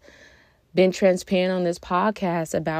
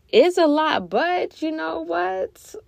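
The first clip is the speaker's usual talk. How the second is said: much higher and louder, stretched through a laugh; a stressed-out mom laughing at her own overload; 11 semitones higher, 4 dB louder, slower than usual. Real, not acted. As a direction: laugh off the stress, then rally. Laughs about being overwhelmed.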